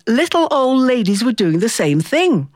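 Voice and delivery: usual speaking voice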